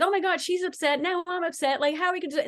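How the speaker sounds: high, worried spiral of panic; anxious husband. Pretend. Friend voices the husband catching his wife's panic, high and anxious. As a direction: sound like he's catching her fear.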